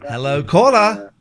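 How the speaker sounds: bright, loud hello to the caller; loud radio greeting. Real, not acted. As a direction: call out like a host.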